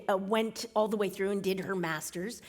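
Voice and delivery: normal talking voice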